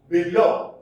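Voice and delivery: teacher's end-stressed "Below"; stress on second syllable. Shown as a demonstration. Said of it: "Stress is on the second syllable".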